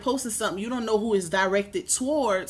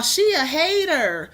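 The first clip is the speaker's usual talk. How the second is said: higher, drawn-out mimicry; mocking critics; 5 semitones higher, 4 dB louder, slower than usual. Streamer repeats what critics call her, mocking. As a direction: imitate the accusers, stretching it out.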